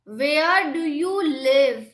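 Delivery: normal talking voice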